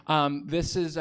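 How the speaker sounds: ordinary talk, nothing marked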